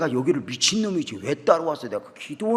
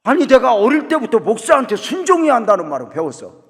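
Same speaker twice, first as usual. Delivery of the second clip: much higher, louder mock complaint; exasperated outcry; 9 semitones higher, 11 dB louder than usual. Real, not acted. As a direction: throw it out to the crowd, half laughing. Preacher exclaims to congregation. (청중에게 높고 크게 외치는 하소연)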